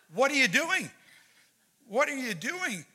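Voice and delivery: high, loud-starting disbelief; incredulous outburst. Retold or imitated. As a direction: demand an explanation in a high, alarmed voice. Pastor acting shocked alarm in dream.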